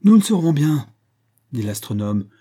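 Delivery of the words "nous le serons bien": high, loud, vexed retort; angry disappointment. A narrator's reading. Astronomer answering Mrs Barnett, loud angry retort. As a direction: snap back loudly in frustration. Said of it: "à la fois la colère et le désappointement".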